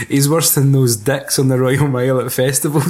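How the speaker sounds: ordinary talk, nothing marked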